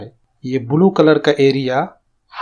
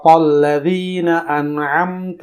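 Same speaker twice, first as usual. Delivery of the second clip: صراط الذین انعمت علیہم slowly, each ع pressed; slow tajweed reading; slow recitation; much slower than usual. Shown as a demonstration. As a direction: recite the verse slow for learners. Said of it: "اسے سنیے اور اس کے مطابق مشق".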